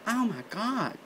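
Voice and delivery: soft, high gasp of dread; quiet, high-pitched horror; stunned dismay. Retold or imitated. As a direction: say it small and appalled. Stunned reply to scope size.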